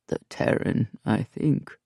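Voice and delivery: low, half-voiced dry reply; a solemn warrior's quiet quip; dry Belliard.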